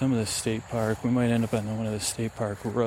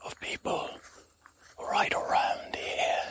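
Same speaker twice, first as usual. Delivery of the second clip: slow, mysterious stage whisper; 4 dB quieter than usual. Acted. Adventurer introducing himself to the ranger, slow whisper. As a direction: whisper it in slow, separate phrases. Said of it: "why are you whispering?".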